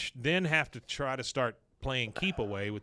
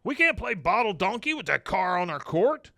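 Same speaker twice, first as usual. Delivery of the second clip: indignant protest; 8 semitones higher, 5 dB louder than usual. Retold or imitated. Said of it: "and he goes".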